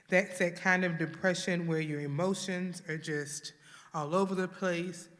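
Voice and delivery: normal talking voice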